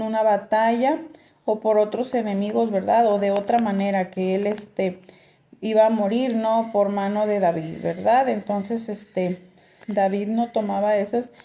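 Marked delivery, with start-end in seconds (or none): none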